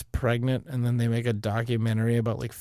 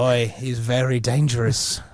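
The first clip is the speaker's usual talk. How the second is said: put-on slow, drawn-out Aussie accent; Aussie wildlife-handler drawl; mock Australian. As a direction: warn them in a hammy Australian accent. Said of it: "to have an Australian accent".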